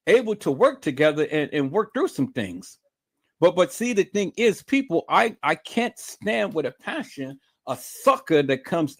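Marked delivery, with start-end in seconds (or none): none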